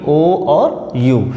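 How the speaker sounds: drawn-out vowels O and U; slow vowel sounds. Shown as a demonstration. Teacher to students, drawing out the vowels. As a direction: stretch each vowel slowly for the class. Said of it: "five sound देता है".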